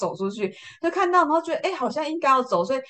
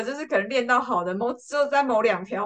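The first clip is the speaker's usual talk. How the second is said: ordinary talk, nothing marked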